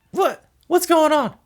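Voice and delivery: high, startled woman's voice; a high, sleepy wife jolted awake. Retold or imitated. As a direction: squeak it out, groggy and alarmed. Woken wife in joke.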